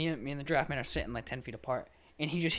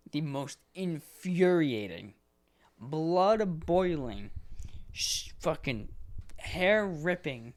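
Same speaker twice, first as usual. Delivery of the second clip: each word stretched slowly for effect; drawn-out emphasis; 3 semitones higher, much slower than usual. Real, not acted. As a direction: savour every angry adjective, slowly. Ranting about phone calls.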